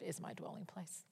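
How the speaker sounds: hushed, reverent whisper. Real, not acted. Preacher whispers the psalm line to the congregation, reverent. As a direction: breathe it like a secret prayer.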